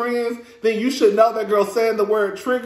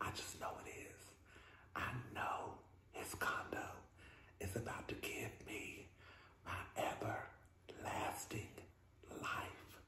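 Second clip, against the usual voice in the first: whisper; 8 semitones lower, 29 dB quieter than usual. Pretend. Dreamy aside about the condo.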